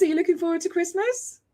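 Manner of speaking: ordinary talk, nothing marked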